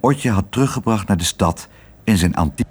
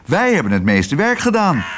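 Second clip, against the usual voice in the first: high, insistent boast; mildly shouted protest; crowing shout; 7 semitones higher than usual. A narrator's reading. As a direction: claim the credit in a raised pitch. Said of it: "schreeuwde Kwark".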